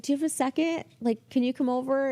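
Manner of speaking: high, sweetly asking voice; tentative request. Retold or imitated. Receptionist asking the boss over, tentative. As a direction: ask a favour, pitched well up. Asks executive for a favour.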